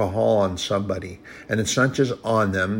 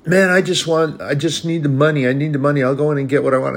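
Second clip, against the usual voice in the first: higher, louder pleading for money; pressing beggar; 5 semitones higher, 4 dB louder, faster than usual. Retold or imitated. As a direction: push for the cash like a man who wants a drink.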